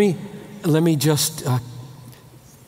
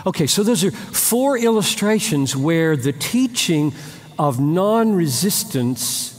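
ordinary talk

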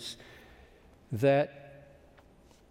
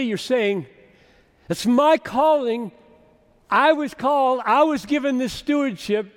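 loud, fast and higher; pressing question; 8 semitones higher, 7 dB louder than usual. Retold or imitated. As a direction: challenge Paul with raised voice. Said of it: "asking the question".

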